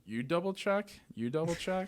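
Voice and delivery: high, mock-flustered echo of a question; a hint of a dim teller; mimicking teller. Imitating teller repeating question.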